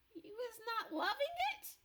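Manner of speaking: high, innocent giant's question. Acted. BFG innocently asking Blood Bottler, high and innocent. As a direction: ask as if you mean no harm. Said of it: "asked innocently".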